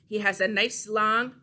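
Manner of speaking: normal talking voice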